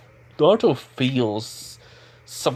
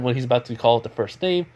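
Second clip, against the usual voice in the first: usual speaking voice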